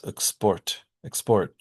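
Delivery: export as ik-SPORT, stress on the second syllable; reduced unstressed vowel. Shown as a demonstration. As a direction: shrink the first vowel of export and stress PORT. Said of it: "they get reduced to uh or I".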